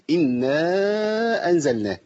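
"إنا" with a long held final aa; elongated madd. Shown as a demonstration. Teacher showing the first madd, "إنا" drawn out long. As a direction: stretch the end of "إنا" before "أنزلناه". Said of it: "أول مد قابلنا".

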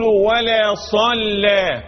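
slow chant of "ولا صلى" with thin L; light lam recitation. Shown as a demonstration. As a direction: stretch "ولا صلى", keeping each L light. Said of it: "الأصل في اللام الترقيق".

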